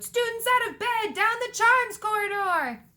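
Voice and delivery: high bellowed alarm; bellowing. A narrator's reading. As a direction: holler it high, giving the culprits away. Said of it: "Peeves bellowed".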